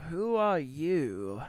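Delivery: slow, wary question; suspicious spearman. Acted. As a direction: eye the stranger and ask slowly. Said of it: "it's like".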